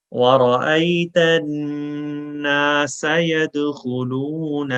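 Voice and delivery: slow, melodic tajweed chant; Quran recitation. Shown as a demonstration. Teacher reciting for students to repeat after, slow melodic chant. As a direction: recite it slowly for students to echo.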